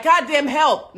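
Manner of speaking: loud mock yell for help; a man yelling for help mid-fight, hammed up; retold scream for help. Boyfriend screaming for help during the fight, mock yell. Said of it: "he starts screaming for help".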